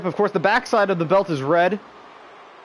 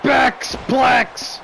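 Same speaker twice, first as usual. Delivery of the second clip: shouted move call; 7 semitones higher than usual. Real, not acted. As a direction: shout the move name like a commentator. Calling the finishing move.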